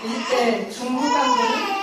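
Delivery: high, loud, slow and tearful 중후반부는; choked-up stress. Real, not acted. Recalling her church-filled twenties, voice breaking on 중후반부는. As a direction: let 중후반부는 crack with tears. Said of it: "contrasting halves of her twenties".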